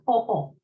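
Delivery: o-o, the long closed o sound held on both halves; o-o double vowel. Shown as a demonstration. Teacher sounding out o-o for the class. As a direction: sound out o-o as two joined o vowels. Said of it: "double omicron".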